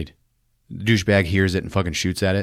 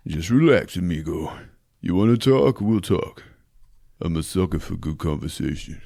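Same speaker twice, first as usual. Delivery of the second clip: mock tough cop; 3 semitones lower than usual. Cobra taunting the gunman, low deadpan growl. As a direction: play it cool and menacing, low.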